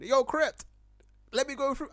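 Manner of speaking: high, loud hail to someone; imagined holler; calling out. Retold or imitated. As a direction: shout across to get his attention.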